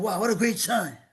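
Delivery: imitating delighted surprise at a road sign; retold amazement. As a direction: marvel at the sign, a touch overplayed. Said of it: "I was so shocked".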